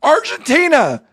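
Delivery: high, loud, emphatic disbelief; aghast play-by-play. Real, not acted. Sportscaster reacting to Messi's missed penalty, aghast and shouting. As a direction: hammer out the shock, then announce the score. Live commentary after the miss.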